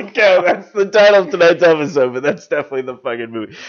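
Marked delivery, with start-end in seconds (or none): 0.0-2.1 loud, high announcer hype; excited announcing. Acted. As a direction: hype the title like a trailer. Announcing a mock movie title.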